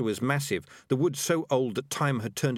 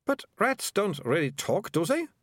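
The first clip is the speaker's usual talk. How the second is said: put-on bewildered girl seeking reassurance; 9 semitones higher than usual. A narrator's reading. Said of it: "now quite bewildered".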